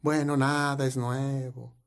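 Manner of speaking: respuesta lenta, baja y apagada; desánimo imitado. Retold or imitated. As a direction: imita al quejoso alargando las palabras con resignación. Imita respuesta desanimada del quejoso. (resignación fingida, floja y lenta)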